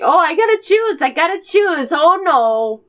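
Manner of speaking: high, flustered Canadian voice; high panicky fluster; panicked Canadian. Retold or imitated. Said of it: "Canada's like".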